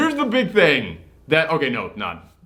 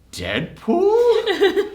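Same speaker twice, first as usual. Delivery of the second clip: mock surprised question. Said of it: "and then you go".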